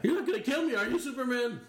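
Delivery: high pleading villain. Acted. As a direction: beg like a cornered crook.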